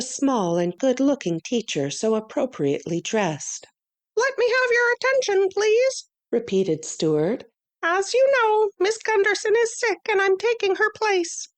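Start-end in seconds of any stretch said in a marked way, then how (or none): 4.2-6.1 much higher, quick and crisp; a tiny teacher calling the class to order; high little mouse-teacher voice; small Stuart voice. Acted. 7.8-11.5 much higher, quick and crisp; a tiny teacher calling the class to order; high little mouse-teacher voice; small Stuart voice. Acted. Stuart explains to the pupils.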